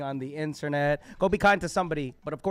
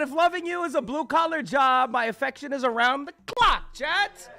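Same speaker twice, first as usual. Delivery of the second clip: higher, louder declaiming of his lines; imitating Habib; 10 semitones higher, 9 dB louder than usual. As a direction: recite his poem like a stage performer. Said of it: "No, he said".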